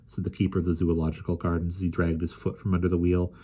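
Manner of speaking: ordinary delivery, nothing marked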